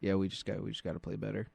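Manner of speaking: low, very soft monotone; robotic, subdued coach impression; Bill Belichick. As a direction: drone through a press conference with no emotion. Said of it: "He talks so mo-, monotone".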